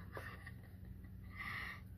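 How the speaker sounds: soft, breathy, voiceless laugh; quiet giggle. Real, not acted. Laughs about her shirt riding up.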